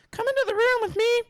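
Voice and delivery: high, loud granny voice; a grandma calling him in; foul mouthed granny. Acted. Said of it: "my grandma voice is not good".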